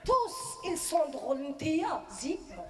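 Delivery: slow, dismissive verdict; a mild mock Moroccan mother scolding; Moroccan mom's put-down. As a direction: drag out t'es zéro like a judge. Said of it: "elle me dit".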